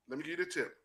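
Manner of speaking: ordinary talk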